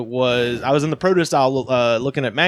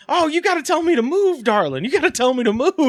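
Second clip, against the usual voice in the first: much higher mock voice, darling; imitating the security lady; 12 semitones higher than usual. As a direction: mimic a startled guard scolding sweetly. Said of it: "she was like".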